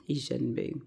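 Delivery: soft, under-the-breath muttering; said to oneself. Retold or imitated. Nurse thinking to herself about his family, muttered. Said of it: "And I said to myself".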